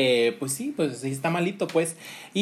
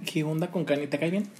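ordinary delivery

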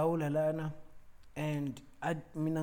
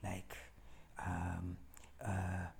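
low, very soft stammered imitation; hesitant reply; 6 semitones lower, 12 dB quieter than usual. Host struggles to admit his interest in the retelling, hesitant and quiet. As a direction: play someone fumbling for words, voice dropped. Acts host's awkward, reluctant answer.

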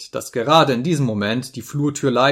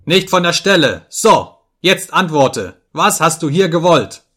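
loud, high angry orders; furious shouted questioning; roaring interrogation; 6 semitones higher, 8 dB louder than usual. A narrator's reading. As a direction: bellow and demand an answer. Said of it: "brüllte ich".

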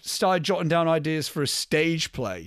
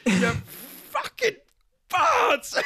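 film quote impression; much slower than usual. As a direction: drag it out, then crack upward, hammed-up. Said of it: "I just end up going like".

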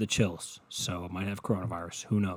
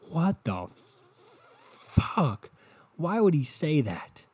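slow, drawn-out stunned disbelief; mock disbelief; 6 semitones higher, much slower than usual. Imagining the doctor watching TV, in slow stunned disbelief. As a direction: stretch it out, baffled. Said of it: "you're just sitting there like".

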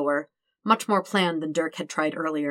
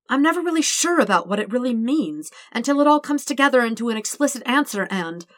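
fast, rushing nervous ramble; nervous rambling; 6 semitones higher, 3 dB louder, faster than usual. Acted. Dirk confessing love to Todd, rushing nervously. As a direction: tumble the words out faster. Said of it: "he just keeps talking".

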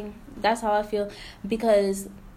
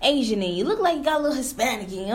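mock doubting asker; 5 semitones higher than usual. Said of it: "somebody will say".

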